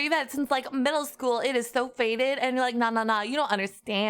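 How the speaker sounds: put-on Valley Girl. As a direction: start on a squeal, then gossip like a Valley Girl. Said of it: "So I talk like a Valley Girl".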